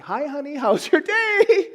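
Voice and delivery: high, slow whiny greeting; husband greeting wife feebly; mock pitiful husband. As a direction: plead for attention, half laughing. Voicing himself pitifully greeting wife.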